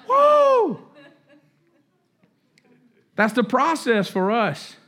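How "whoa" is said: a very high, louder awed whoa; be blown away; student amazed at himself. Retold or imitated. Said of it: "he's like".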